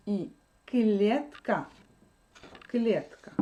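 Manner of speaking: ordinary talk, nothing marked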